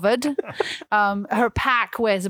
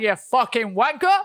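retold British "you fucking wanker"; a light British accent on the insult; a mild British-accent insult. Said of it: "said it in a full-on British accent".

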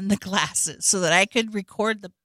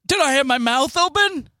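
Tony voice; 7 semitones higher, 5 dB louder than usual. Retold or imitated.

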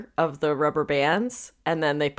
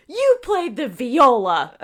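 slow loud announcement; 7 semitones higher, 6 dB louder, slower than usual. Pretend. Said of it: "been like".

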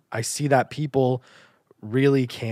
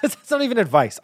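laughing at himself; 13 semitones higher, 7 dB louder than usual. Real, not acted. Laughs at his own advice.

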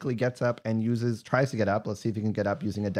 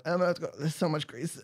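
rogue voice; 6 semitones higher than usual. Acted. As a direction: voice the rogue in a slip. Rogue voiced slipping in grease.

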